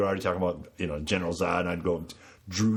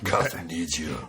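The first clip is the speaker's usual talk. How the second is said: Batman voice; 3 semitones lower than usual. Retold or imitated.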